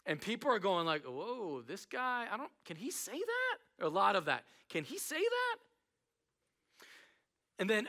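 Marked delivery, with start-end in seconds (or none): 1.0-3.9 hushed, high-rising mock astonishment; murmured disbelief. Crowd reacting to Jesus's bold claims, softly incredulous. 4.7-5.6 high, rising incredulous question; mock disbelief. Crowd questioning Jesus's claims, in high-pitched disbelief.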